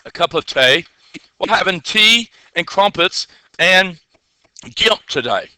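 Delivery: playful mock English accent. Teacher clowning for the class over tea, mock English accent. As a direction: put on an English accent, savouring the words. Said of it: "I was in English there for a second".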